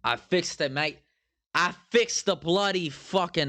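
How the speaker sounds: hammed-up Brit, veering Aussie; bad British accent. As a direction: put on an over-the-top British accent that drifts toward Australian. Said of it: "like, a British accent".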